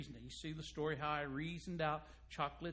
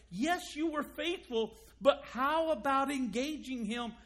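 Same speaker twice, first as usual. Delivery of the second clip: preaching challenge; 6 semitones higher, 7 dB louder than usual. Acted.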